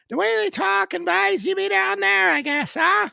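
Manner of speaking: high, loud Newfoundland voice; Newfoundland accent. Retold or imitated. Podcast guest shows his Newfoundland accent to his co-host, high and loud. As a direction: ham up a Newfoundland local. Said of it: "it's an Irish sort of accent".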